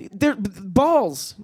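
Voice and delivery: mock horror. Waking naked in daylight, a high horrified whine. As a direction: recoil at the sight in daylight.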